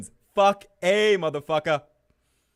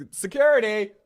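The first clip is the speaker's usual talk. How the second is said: loud call for security; 6 semitones higher, 7 dB louder than usual. Acted. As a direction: shout for security. Calling security in skit.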